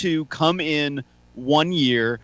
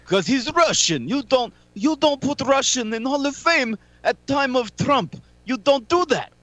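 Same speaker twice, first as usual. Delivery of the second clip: put-on higher, broken-English heel accent; Iron Sheik impression; 9 semitones higher than usual. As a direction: mangle the grammar like an old wrestling villain. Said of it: "You just sounded like the Iron Sheik".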